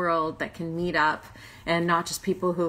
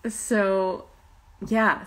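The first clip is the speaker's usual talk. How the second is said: ordinary talk, nothing marked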